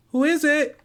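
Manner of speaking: put-on high little girl asking warily through a door; a wary child behind the door; small child's voice.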